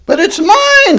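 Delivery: very high, loud furious scream. Acted. Maisie claiming the hatched egg, very high furious scream. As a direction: shriek to claim it back. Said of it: "screamed the bird".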